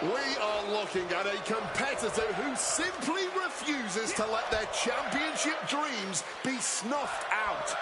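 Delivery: dramatic commentary. Acted. Commentator hyping the comeback.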